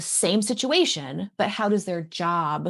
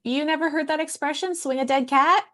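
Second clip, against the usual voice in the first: much higher, louder retold disbelief; incredulous; 9 semitones higher, 3 dB louder than usual. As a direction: act amazed they never heard it. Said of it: "And I was like".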